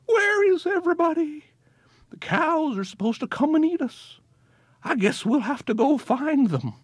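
high, puzzled little voice; high grass voice. A narrator's reading. Grass in the field looking for the cows, high and puzzled. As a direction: wonder aloud like lost grass.

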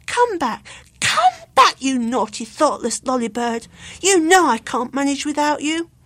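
loud, high begging cries; desperate pleading shout; pleading call. A narrator's reading. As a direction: beg the bird to come home. Luke pleads with the bird.

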